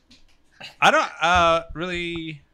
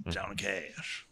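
[name] voice; 4 semitones lower, 7 dB quieter, much slower than usual. Retold or imitated. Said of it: "And then you got".

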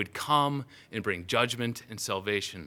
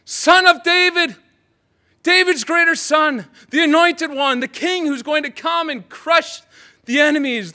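crying out; 13 semitones higher, 12 dB louder than usual. Acted. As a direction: cry out to Jesus across the crowd. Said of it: "begins to cry out".

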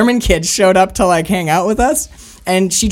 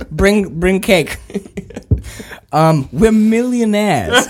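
slow, higher-pitched mock demand; Withnail; 5 semitones higher, 3 dB louder, slower than usual. Quoting a film scene with friends, slow mock grandeur. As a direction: draw it out like a hammed-up film quote. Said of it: "very alternative Withnaw and I scene".

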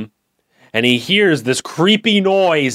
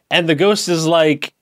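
ordinary delivery

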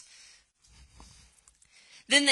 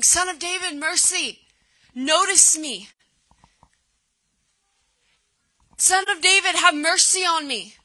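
high, raised plea to be noticed; crying out; 5 semitones higher than usual. Acted. Blind beggar hears Jesus coming near, crying out to him. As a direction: call out for mercy, pitch lifted. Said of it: "he starts crying out".

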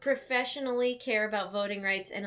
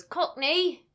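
bad Australian accent; 5 semitones higher, 4 dB louder than usual. Shown as a demonstration. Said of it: "My Australian always goes".

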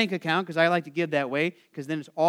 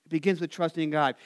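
ordinary delivery

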